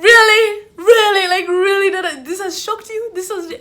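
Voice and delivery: high, loud mock-incredulous questioning; exasperated disbelief. Student reacting to the dramatic deputy, exasperated disbelief. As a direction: fire it out high, starting very loud.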